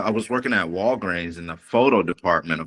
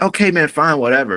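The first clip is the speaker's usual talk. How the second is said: higher and louder, fed up; annoyed okay-fine to a pushy friend; exasperated giving in; 8 semitones higher, 6 dB louder, faster than usual. Retold or imitated. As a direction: throw up your hands and cave.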